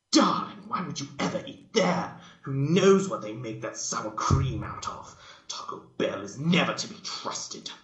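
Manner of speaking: high, loud, scandalised; fussy lady appalled by Taco Bell; Rarity crying out. Acted. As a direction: clutch your pearls and cry out. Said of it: "Rarity cried".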